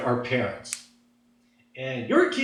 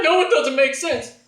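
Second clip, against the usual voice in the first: put-on high-pitched exasperated teenager; mock-frustrated high voice; acted protest; 7 semitones higher, 3 dB louder than usual. Son protesting to parent.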